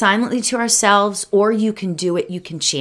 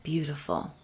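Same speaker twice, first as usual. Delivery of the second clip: guided meditation; 6 dB quieter than usual. Acted. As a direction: ease them into calm, one breath at a time.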